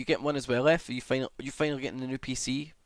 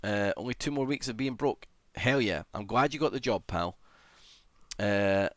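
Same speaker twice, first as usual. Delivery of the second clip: normal talking voice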